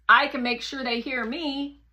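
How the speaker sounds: usual speaking voice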